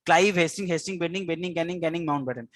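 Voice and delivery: quick chanted list, each round falling on the last name; rhythmic drill. Shown as a demonstration. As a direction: drum the names in as a rhyme. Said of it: "এই ছন্দটা তোমাকে লাগবেই".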